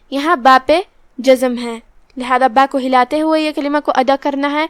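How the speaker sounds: ordinary talk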